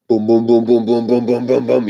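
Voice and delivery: rhythmic booming to mimic the quake; imitated rumbling. Storyteller mimicking the earthquake shaking the house, rhythmic booms.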